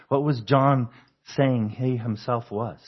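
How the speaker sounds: ordinary delivery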